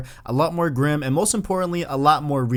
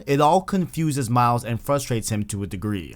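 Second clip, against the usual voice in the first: usual speaking voice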